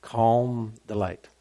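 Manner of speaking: soft, settling reassurance; calm delight. Shown as a demonstration. Calming down after acted freak-out.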